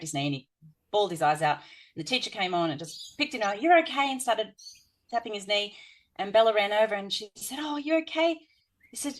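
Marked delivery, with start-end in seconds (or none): 3.6-4.1 high, bright soothing voice for the teacher; mock-cheerful you're okay. Teacher soothing a crying boy. 7.6-8.4 put-on higher voice for a five-year-old consoling; gentle childlike reassurance; little girl comforting. Voicing young Bella comforting.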